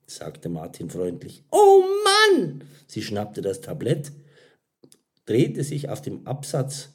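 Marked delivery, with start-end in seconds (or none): 1.5-2.6 high, loud exasperated outburst; frustrated server. A narrator's reading. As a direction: exclaim in high-pitched dismay. Mira's frustrated exclamation over mistake.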